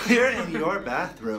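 repeating it through laughter; laughing. Real, not acted. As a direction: laugh while saying it. Repeats guess while laughing.

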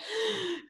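high, choked gasps for air; strangled gasps. Acted. Headmistress gasping at the chalk message, strangled gasps. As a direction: gasp like someone being strangled. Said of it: "giving out a series of strangled".

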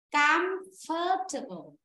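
slow, syllable by syllable, comfortable; teacher models comfortable slowly for the class, each syllable drawn out; slow demo. Shown as a demonstration. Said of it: "Và đọc này".

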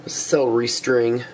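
normal talking voice

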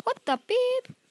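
shocked squeal at the screen; sudden high outburst of surprise; startled yelp. Real, not acted. Surprised by game glitch.

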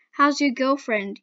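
usual speaking voice

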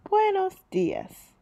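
put-on high, slow child's greeting. Girl greeting the shopkeeper back, high and slow. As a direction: answer back in a small child's voice.

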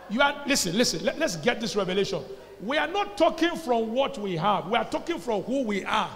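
normal talking voice

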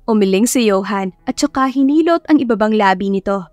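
ordinary delivery

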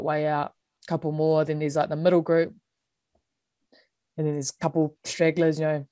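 ordinary talk

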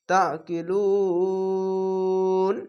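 তা কি লুন with the final vowel held very long before stopping; three alif stretch. Shown as a demonstration. Teacher demonstrates the three-alif stretch when stopping. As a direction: hold the last vowel of লুন for three alifs. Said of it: "তিন আলিফ টেনে পড়তে হবে".

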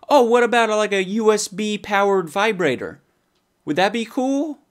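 high, goofy voice pitching a dumb idea; mock excited idea. To himself, mocking his own gag gift idea, high goofy voice. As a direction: squeak it out like a proud dimwit.

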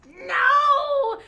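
high, loud, horrified scream of no; a creature's dying cry of denial; horrified angry shout. Acted. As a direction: scream it in horror and rage. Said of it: "horrified and angry".

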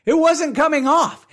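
storyteller's exasperated outburst. Real, not acted. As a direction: stress the frustration. Exasperated narration.

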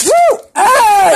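loud high whoop; cheering. Real, not acted. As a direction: whoop with joy.

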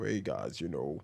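low, hushed mock greeting to viewers; put-on deep voice. Shown as a demonstration. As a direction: drop right down into a put-on voice. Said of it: "I don't try to go".

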